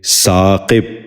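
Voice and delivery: ثاقب ending "-qib", short i; short i before the final b of ثاقب; correct pronunciation. Shown as a demonstration. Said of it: "ذہن نشین کر لیجئے".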